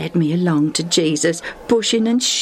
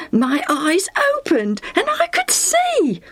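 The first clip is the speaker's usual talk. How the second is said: high, thrilled exclamation; amazed joy; 12 semitones higher than usual. A narrator's reading. Lucius relives gaining his sight, thrilled. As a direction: tell the miracle with soaring excitement.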